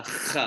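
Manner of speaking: "ch" as a throaty scraped kh sound; guttural kh. Shown as a demonstration. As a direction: rasp "ch" at the back of the throat, like Hebrew chaf. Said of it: "like, very guttural".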